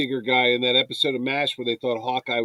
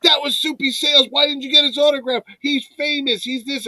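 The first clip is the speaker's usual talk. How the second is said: high-pitched indignant scolding; mad father, imitated; 11 semitones higher than usual. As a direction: scold like an outraged dad, no shouting. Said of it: "He was so mad".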